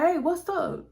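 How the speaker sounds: high, light greeting in Tiffany's voice; a lightly voiced friendly girl. Tiffany greets the watching man.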